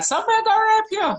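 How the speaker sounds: a gran yelling at ripped jeans; imitating gran's shout. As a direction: yell it like a scandalised gran. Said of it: "Second gran".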